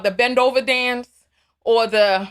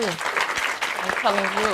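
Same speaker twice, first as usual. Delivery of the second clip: I'm telling you drawn out slowly and low; slow, knowing repeat; 3 semitones lower, 3 dB quieter, much slower than usual. Real, not acted. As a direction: let the words sink in slowly. Repeats the agreement slower.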